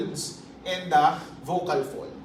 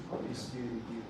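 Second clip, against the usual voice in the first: "Oh, yes, very good" much softer and lower, still voiced; soft voiced murmur; 9 semitones lower, 15 dB quieter than usual. Shown as a demonstration. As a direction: murmur it quietly but keep the voice on. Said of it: "pabulong na mahinang voice lang".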